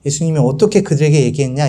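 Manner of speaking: normal talking voice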